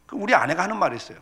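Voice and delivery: usual speaking voice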